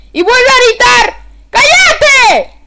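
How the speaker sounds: high, full-volume yell; real angry shout. Real, not acted. Streamer fed up with the dog's noise, furious shouting. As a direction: scream for silence, drawing it out. Dog cries out again, he yells.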